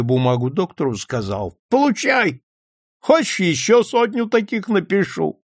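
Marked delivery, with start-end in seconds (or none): 1.7-5.4 high, boastful offer; cocky, pitch-up tipsy exclaiming; drunken bravado. A narrator's reading. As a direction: hand it over with a flourish. Drunk man flourishes signed note.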